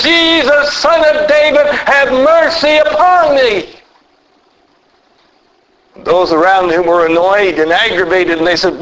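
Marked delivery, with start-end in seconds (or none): 0.0-3.7 higher, pleading cry to be heard over a crowd; crying out loudly. A narrator's reading. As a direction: call out to Jesus above the crowd, begging. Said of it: "cry out with a loud voice".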